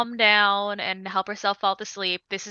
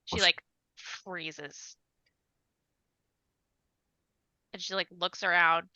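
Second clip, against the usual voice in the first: hushed suspense; 8 dB quieter than usual. A narrator's reading. Hearing a ghost, frozen.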